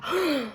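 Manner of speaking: sharp high gasp; demonstrated gasp. Shown as a demonstration. Reader showing the class how to gasp, sharp and high. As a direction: gasp in on cue. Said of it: "Can you do it for me".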